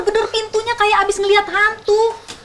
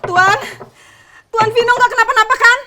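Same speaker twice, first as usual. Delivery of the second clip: worried calling; 4 semitones higher, 4 dB louder than usual. Acted. Calling through a locked door.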